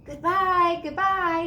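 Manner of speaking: high, loud farewell call; girl on the rising sleigh, calling goodbye. A narrator's reading. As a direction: call it over the wind. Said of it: "she called".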